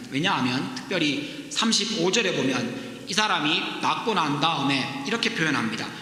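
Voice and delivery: normal talking voice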